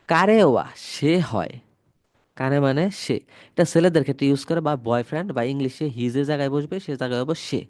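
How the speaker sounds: ordinary delivery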